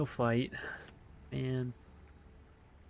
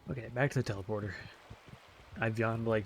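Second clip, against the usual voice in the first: usual speaking voice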